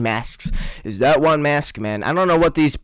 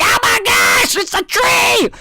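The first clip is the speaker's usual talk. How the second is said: high, shrieking panic at a tree; mock scream; slower than usual. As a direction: shriek it out in fake terror. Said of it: "It's like".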